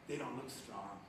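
soft sincere slowdown. Real, not acted. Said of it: "tender close".

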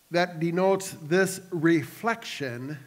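normal talking voice

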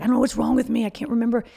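a mild mock of him scolding himself; berating himself. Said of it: "He would berate himself".

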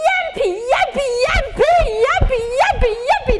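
high, loud, repeated yelps; mock cry of pain. Shown as a demonstration. Teacher urges class to feel the pain, hammed-up screaming. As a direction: yelp it over and over, hamming up the hurt. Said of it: "You gotta feel the pain".

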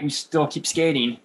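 ordinary delivery

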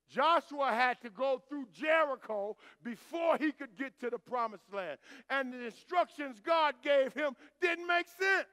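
loud, rising preacher's call; loud, building sermon delivery; preaching at full force. Acted. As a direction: drive the point home to the congregation.

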